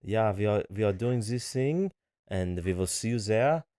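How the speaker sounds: hammed-up German, W's turned to V's; cartoonish German accent. Shown as a demonstration. Husband performs on request, cartoonish German accent. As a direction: play a cartoon German host inviting guests. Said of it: "I could do, like, a cartoonish German one".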